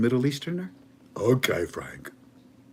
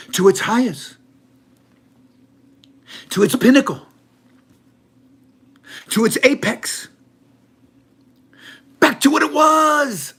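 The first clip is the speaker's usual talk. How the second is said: each phrase higher and louder, ending in a high shout; building to a shout; 13 semitones higher, 10 dB louder than usual. Acted. He hypes the currency's return, building to a shout. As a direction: climb step by step to a yell.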